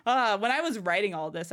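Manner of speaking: ordinary talk, nothing marked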